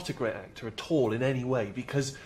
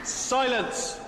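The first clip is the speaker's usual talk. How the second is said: booming call for silence; 12 semitones higher than usual. Acted. Announcer calling the hall to order.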